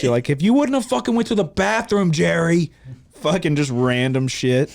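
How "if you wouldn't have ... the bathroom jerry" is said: loud, high-pitched accusation; hammed-up exasperated scolding; mock blaming. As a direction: imitate a fan scapegoating a friend. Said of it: "you start blaming it on everybody else".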